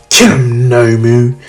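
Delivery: loud barked order; villain command. Retold or imitated. Shigaraki ordering Nomu to attack, loud command. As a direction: order the monster to kill, loud.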